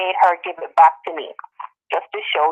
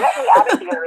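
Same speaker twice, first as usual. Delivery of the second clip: excited plea. Retold or imitated. Eager plea to leave.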